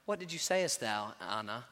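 lightly voiced stiff, low churchy tone; mock formal pastor. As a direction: play the pompous pastor as a joke. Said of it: "I'm like".

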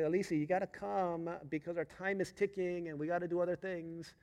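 retold dad hurrying a reluctant child; impatient coaxing. Dad urging balking daughter.

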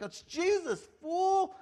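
high, cheeky put-down; sassy Jesus. Retold or imitated. Jesus jokingly telling Saul off, high and sassy. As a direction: tease him playfully.

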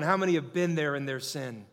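ordinary talk

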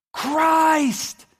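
high, loud, drawn-out yell. Acted. Peter realizing it is Christ, yelling. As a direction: cry it out at full voice. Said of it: "He yells".

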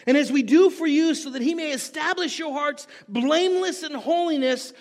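high, loud, insistent pulpit delivery; fervent preaching. Real, not acted. Preacher insisting there is a way that honors God, fervently. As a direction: drive it home to the congregation.

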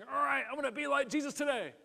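high, hurried mock determination; straining resolve. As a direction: push it out as if straining with effort. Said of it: "by the sweat of your brow saying".

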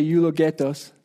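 eulogetos with a Spanish accent; Spanish-accented Greek. Real, not acted. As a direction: give the Greek word Spanish-style pronunciation. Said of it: "I say it with a Spanish accent".

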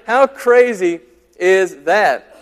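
ordinary delivery

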